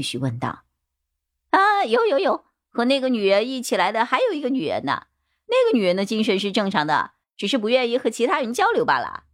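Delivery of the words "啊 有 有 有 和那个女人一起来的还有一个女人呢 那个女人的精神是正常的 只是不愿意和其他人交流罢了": high-pitched, eager-to-cooperate suspect; eager bald suspect. Acted. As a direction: hurry to volunteer all you know, pitched up. (光头嫌犯尖着嗓子连忙交代)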